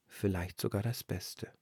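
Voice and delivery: plain reading voice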